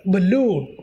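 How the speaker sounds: balloon as buh-LOON, LOON stressed; stress on second syllable. Shown as a demonstration. Teacher modelling word stress for students, balloon stressed on -LOON. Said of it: "second syllable go to the str".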